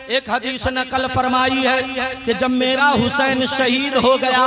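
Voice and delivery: ordinary talk, nothing marked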